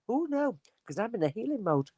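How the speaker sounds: high, soft retold protest; acted reluctance. Healer declining to pass on spirit's message, acted reluctance.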